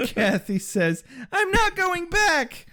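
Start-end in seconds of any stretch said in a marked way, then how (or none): 1.3-2.7 put-on high, slow whiny teenager; whiny teen voice. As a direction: pout it like a teenage girl. Kathy voiced, teen pout.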